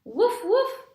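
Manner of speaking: high, loud playful woof; a child's-book puppy voice going woof woof; dog barking. Acted. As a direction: bark like a cheerful little dog. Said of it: "said his dog".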